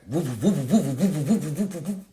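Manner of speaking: quick repeated siren whoops; vocal squad car whoop; imitated police siren. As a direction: wobble up and down like a siren.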